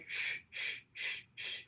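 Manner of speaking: put-on soft breathy shushing of a baby; soothing shush. Imitating Ro shushing the fussing baby, soft shush. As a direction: shush gently as if rocking an infant. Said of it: "but what I heard was".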